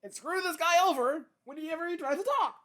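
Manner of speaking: mucus voice. Pretend. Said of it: "mucus production in my body was like".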